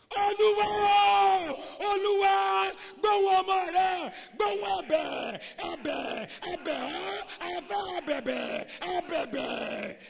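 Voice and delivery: high, wailing full-force plea; shouted prayer. Acted. Worshippers who think God is far away, shouting prayer. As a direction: cry out to a faraway God. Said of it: "why do we shout?".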